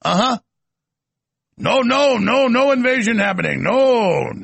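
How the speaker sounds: mock Russian denial. Mocking Russian host denying invasion.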